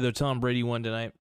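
normal talking voice

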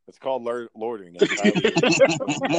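cartoon deadpan, rising high and loud on illegal; Aqua Teen Hunger Force impression. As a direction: parrot the cartoon's smug legal line. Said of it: "I was ripping off Aqua Teen Hunger Force".

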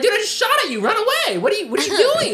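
high-pitched mock exasperation; acted disbelief in a high voice; incredulous pleading. Retells pleading with the deer.

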